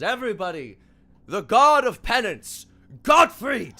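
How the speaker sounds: loud, high arena announcer. Acted. Alistair presenting a new god to the crowd, booming announcer. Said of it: "Alistair goes".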